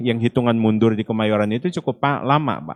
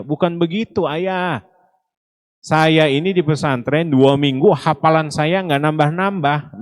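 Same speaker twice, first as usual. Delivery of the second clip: higher, insistent imitated son's protest; pleading protest; 6 semitones higher, 3 dB louder than usual. Son answers his angry father, pleading protest. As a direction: act out a child earnestly explaining himself, pitched up.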